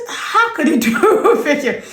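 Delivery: slow, high question through a laugh; laughing disbelief. Retold or imitated. Herself laughing at her friend over the fake yam. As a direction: laugh at the absurd idea. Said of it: "I said".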